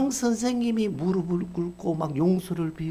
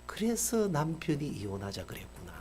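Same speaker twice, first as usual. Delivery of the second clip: softer, inward muttered thought; quiet realization; 3 semitones lower, 6 dB quieter than usual. Retold or imitated. Principal realizes why her husband wanted divorce, murmuring.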